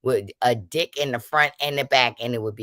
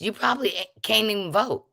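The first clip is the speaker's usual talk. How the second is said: high mocking taunt; 9 semitones higher than usual. Real, not acted. A higher voice too. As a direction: pitch jumps up, teasing scorn. Taunting viewers who grew up poor.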